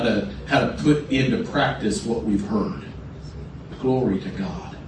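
soft, hushed prayer guidance; hushed altar-call voice; quiet prayer leading. Real, not acted. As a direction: lead them gently into prayer. Prayer leading, eyes closed.

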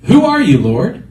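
high, loud startled cry; startled question. Acted. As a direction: Saul thrown to the ground, crying out in shock. Saul struck down on the road.

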